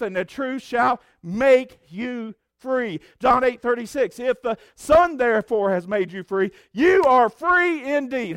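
loud, high-pitched pulpit delivery, slowed for emphasis; fervent preaching. Acted. Preacher proclaiming scripture to the congregation, fervent. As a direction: proclaim it to the whole church.

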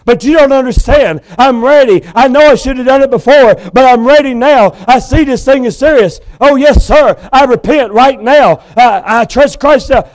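condemned man begging the judge; desperate pleading. Acted. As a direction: beg for a last chance. Felix begging to be saved.

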